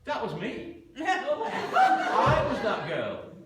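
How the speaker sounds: high, loud and slow through laughter; the bride revealing she was the woman; laughing revelation. Retold or imitated.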